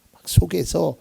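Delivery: normal talking voice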